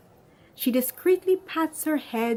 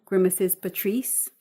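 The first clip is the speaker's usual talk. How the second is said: ordinary delivery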